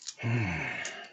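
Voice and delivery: long falling sigh; heavy sigh. Real, not acted. Sound while recounting disgust.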